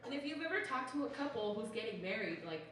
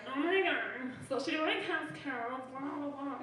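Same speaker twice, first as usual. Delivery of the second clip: high, loud caricature of gossips; mocking gossipy people; 4 semitones higher, 4 dB louder, slower than usual. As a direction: babble it like a mock gossip, voice pitched up. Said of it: "that's how people sound to me".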